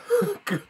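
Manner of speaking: squeaky high-pitched giggle. Real, not acted. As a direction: let out a short squeaky laugh. Laughing at herself.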